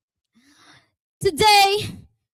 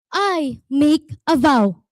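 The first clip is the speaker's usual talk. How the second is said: ordinary talk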